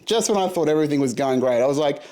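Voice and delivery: ordinary talk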